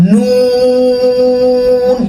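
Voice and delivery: নুন held long with lips rounded; drawn-out Arabic letter. Shown as a demonstration. Teacher demonstrating how to stretch নুন, drawn out. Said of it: "ঠোঁট একদম গোল হবে".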